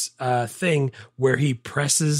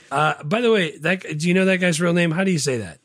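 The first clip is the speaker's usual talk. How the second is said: ordinary talk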